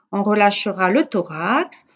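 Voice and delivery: ordinary talk, nothing marked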